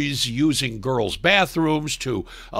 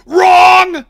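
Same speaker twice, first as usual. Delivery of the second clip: high, loud mocking shout; shouted wrong; 11 dB louder than usual. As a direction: yell it with a laugh.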